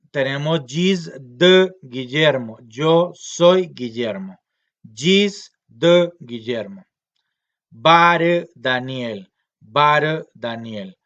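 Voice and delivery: gis de Guillermo and bare Daniel said slow and loud, word by word; slow model sentences. Shown as a demonstration. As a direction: dictate each Cabécar phrase for students to repeat. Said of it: "Usted es Daniel".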